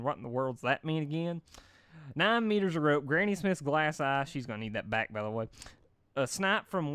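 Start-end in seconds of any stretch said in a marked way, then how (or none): none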